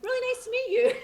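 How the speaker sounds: high, loud star-struck gush; mock fangirl squeal. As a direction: squeal it like you just met a star. Gushing retold reply to Roger.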